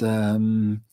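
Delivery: ordinary talk, nothing marked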